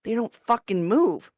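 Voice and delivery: ordinary talk, nothing marked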